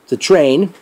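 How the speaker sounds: ordinary talk, nothing marked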